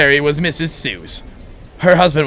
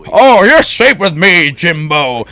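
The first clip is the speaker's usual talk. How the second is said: mock reassuring; 3 semitones higher, 8 dB louder, slower than usual. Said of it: "trying to reassure the young boy".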